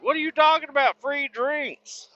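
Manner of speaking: high, baffled double-take; incredulous. Retold or imitated. Asking coworkers about free drinks, high and incredulous. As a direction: ask it like you can't believe it.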